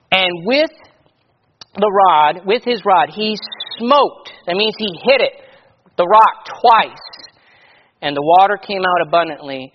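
loud, measured King James reading voice; scripture reading. Acted. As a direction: read the Bible passage out to the church with weight. Preacher reads scripture aloud.

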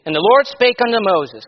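loud, measured King James reading voice; scripture reading. Acted. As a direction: read the Bible passage out to the church with weight. Preacher reads scripture aloud.

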